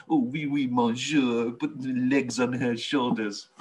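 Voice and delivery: lightly voiced mock French man; a hint of a hammy French accent; french chef. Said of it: "I don't like Harry".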